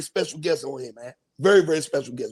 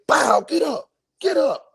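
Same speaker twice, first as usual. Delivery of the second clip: high, urgent mock wake-up call; imitated rousing; 8 semitones higher, slower than usual. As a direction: act out jolting someone awake, pitched up. Acts out smacking someone awake.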